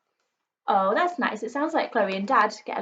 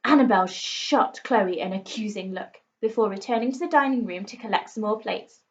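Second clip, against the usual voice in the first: usual speaking voice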